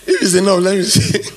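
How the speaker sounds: words breaking into laughter; laughing while teasing the congregation. Real, not acted. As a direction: giggle through the line. Laughing at own joke.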